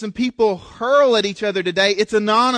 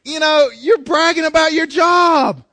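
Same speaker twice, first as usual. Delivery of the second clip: higher and louder, calling it out; exasperated exclaim; 7 semitones higher, 6 dB louder than usual. Real, not acted. Pastor calling out a bragging poster, loud exasperation. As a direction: let the exasperation burst out. Calls out the humble brag.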